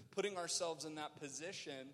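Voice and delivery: usual speaking voice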